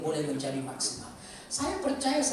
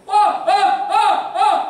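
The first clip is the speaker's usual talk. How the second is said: loud, high repeated yells; shouting; 17 dB louder, much slower than usual. Retold or imitated. Preacher re-enacts training his voice in a basin, shouting. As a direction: belt out short bursts like a voice-power drill. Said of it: "lalu saya teriak".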